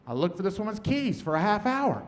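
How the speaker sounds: ordinary delivery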